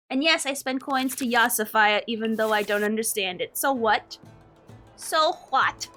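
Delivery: normal talking voice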